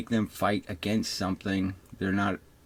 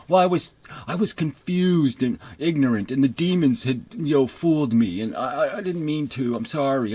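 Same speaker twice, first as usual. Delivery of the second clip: higher, louder mock-desperate begging; hammed-up plea for forgiveness; mock pleading; 5 semitones higher, 5 dB louder than usual. Believer pleading at God court.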